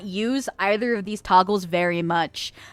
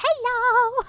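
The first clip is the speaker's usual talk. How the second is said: a bright greeting much higher through a pitch filter; high voice toggle. Pretend. VTuber greeting chat through a voice toggle, high squeak. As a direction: chirp it like a cartoon.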